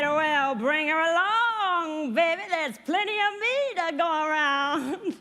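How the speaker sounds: loud, high Munchkin come-on; mock Munchkin boast, high and drawn out; lecherous Munchkin. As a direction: belt it out as a brash little suitor. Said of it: "There's this one little Munchkin".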